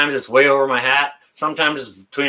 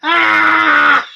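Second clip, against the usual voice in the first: high, loud howl of real laughter; loud laughing shriek; 6 dB louder than usual. Real, not acted. Streamer losing it at a chat joke, laughing shriek. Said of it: "You got me laughing here".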